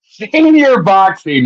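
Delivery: "senior" stretched slow and high, falling at the end; drawn-out amused emphasis. Real, not acted. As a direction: savor "senior boxing" slowly, amused. Mocking the senior boxing idea.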